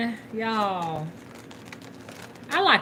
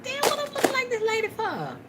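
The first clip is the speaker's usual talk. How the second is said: high, incredulous self-quote; high-pitched retelling; 11 semitones higher than usual. Retold or imitated. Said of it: "I said".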